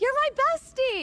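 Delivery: very high, overeager gush; gushing fan. Retold or imitated. Fan greeting a celebrity as a bestie, high gushing. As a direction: throw yourself at a stranger.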